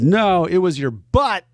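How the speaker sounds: put-on cheeky kid. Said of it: "Rue said".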